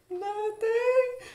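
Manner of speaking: extremely high, put-on falsetto; squeaky fake denial. Bank teller covering up her voice to customer, squeaky falsetto. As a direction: overcompensate with an absurdly high voice. Denying anything is wrong with voice.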